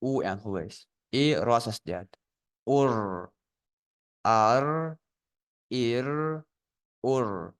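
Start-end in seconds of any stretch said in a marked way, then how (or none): 2.7-3.3 أر said as "uur", one syllable with a rolled r; blended syllable. Shown as a demonstration. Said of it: "ألف أو لحظة". 4.2-7.5 أر، إر، أر as "aar, iir, uur", each drawn out; syllable recap. Shown as a demonstration. Teacher recaps the three blended syllables.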